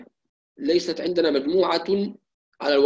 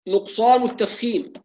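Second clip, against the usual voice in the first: ordinary talk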